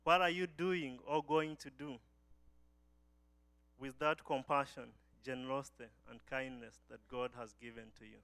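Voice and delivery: ordinary delivery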